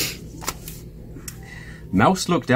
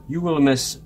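higher, mildly pleading cry; 6 semitones higher than usual. A narrator's reading. Mouse tempts sleepy Bear to stay up, crying out. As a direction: plead a little, pitched up. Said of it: "cried Mouse".

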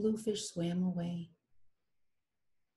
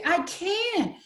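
high protest; 11 semitones higher, 5 dB louder than usual. Acted. Rainbow Fish protests.

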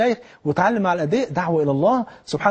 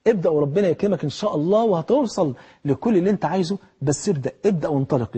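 ordinary talk, nothing marked

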